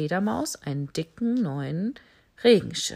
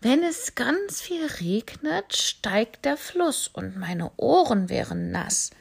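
much higher, slow dreamy bat voice; 5 semitones higher than usual. Acted. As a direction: bat musing about the rising river. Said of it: "sagte sie". (hohe, verträumte Fledermausstimme)

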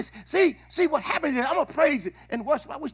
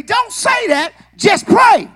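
urging wife; 8 semitones higher, 7 dB louder, slower than usual. Retold or imitated. Wife urging him in line.

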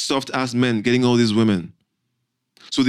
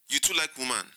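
judgmental; 2 semitones higher, 9 dB quieter than usual. Retold or imitated. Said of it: "in that judgmental tone".

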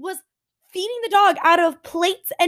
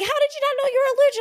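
high, rapid mock asking; mock doctor; 12 semitones higher, much faster than usual. Imitating a doctor quizzing about allergy, quick mock voice. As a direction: rattle it off in a put-on voice.

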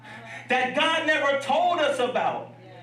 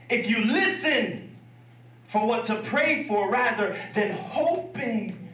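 usual speaking voice

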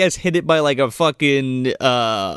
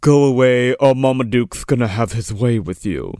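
menacing butler; 5 semitones lower than usual. Retold or imitated. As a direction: warn off a caller in a low voice. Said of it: "this cocaine fiend of a butler".

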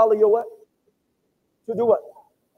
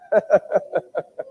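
short laugh; 4 semitones lower than usual. Real, not acted. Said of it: "I'm not laughing at you".

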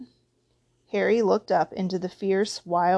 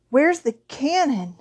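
higher, slow, dim-witted question; stupidly; 6 semitones higher, 4 dB louder than usual. A narrator's reading. Dudley jerked awake by the knocking, asking stupidly. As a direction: ask it like someone dopey and just woken. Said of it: "he said stupidly".

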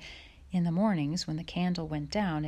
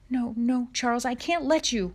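higher, louder pleading protest; distressed refusal; 7 semitones higher, 5 dB louder than usual. A narrator's reading. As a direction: refuse him in alarm. Ma refuses to let Pa go.

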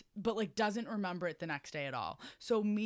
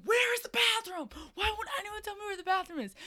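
very high, mock-indignant complaint; indignant demand; 4 dB louder than usual. Sleepwalking friend demanding the bathroom, high and indignant. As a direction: imitate an exasperated friend in a high voice.

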